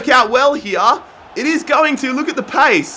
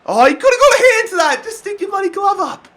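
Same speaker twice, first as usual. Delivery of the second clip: exasperated; 11 semitones higher, faster than usual. Real, not acted. Keeper fails to save.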